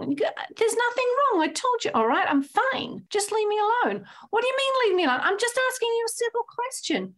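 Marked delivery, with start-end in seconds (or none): 0.6-4.1 much higher, exasperated push-away; 放っておいてくれと苛立つ夫の高い声; irritated husband. Retold or imitated. Husband snapping at wife. 4.3-7.1 much higher, hurt and insistent; 責めるように食い下がる高い声; indignant wife. Retold or imitated. Wife retorting in quarrel.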